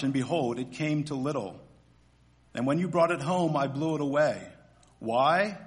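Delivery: normal talking voice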